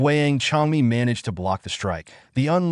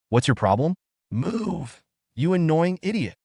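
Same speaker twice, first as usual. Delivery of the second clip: higher, lightly voiced angry outburst; a hint of an angry shout; 6 semitones higher than usual. A narrator's reading. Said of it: "turned angrily, shouting".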